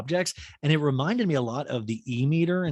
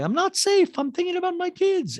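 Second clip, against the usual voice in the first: Dorit impression; 4 dB louder than usual. Dorit praising PK in dual talking head, high put-on voice. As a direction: coo it in a squeaky voice.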